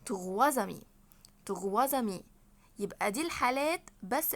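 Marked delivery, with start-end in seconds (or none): 0.1-2.2 "تغوا زامي" linked with a buzzing Z. Shown as a demonstration. Teacher shows how to say three friends, "تغوا زامي" with Z. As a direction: run trois into amis, "تغوا زامي". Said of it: "هتتنطق زد".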